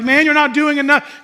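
high, loud imitated complaint; mock nagging. Imagined wife nagging her husband, mock loud scolding. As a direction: throw it out as a loud nag.

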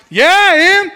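high, loud cocky whoop of agreement; strutting pride. Retold or imitated. Husband reacting to his wife's praise, strutting loudly. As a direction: puff up and shout it proudly. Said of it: "I started strutting a little bit".

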